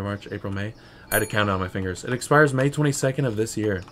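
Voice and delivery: normal talking voice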